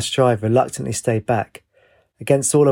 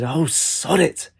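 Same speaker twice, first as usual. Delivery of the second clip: mild, drawn-out exasperated cry, higher in pitch; annoyed shout; 4 semitones higher, much slower than usual. A narrator's reading. Captain curses while tumbling over, understated annoyed shout. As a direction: curse slowly with raised pitch, only mildly shouted. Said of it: "he shouted".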